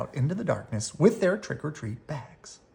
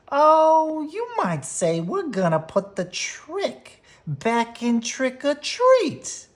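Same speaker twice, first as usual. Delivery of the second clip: high, slow, sly bully voice; chuckling Too Tall; 13 semitones higher, 4 dB louder, slower than usual. A narrator's reading. Too Tall boasting to Brother, high sly chuckling voice. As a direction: savor the trick like a smug bully. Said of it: "he said, chuckling".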